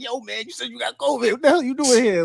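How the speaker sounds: high, mock-outraged coworker calling him out; mock accusing coworker. Coworker catching him at the office, high mock outrage. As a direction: pitch it up like a shocked coworker catching a liar.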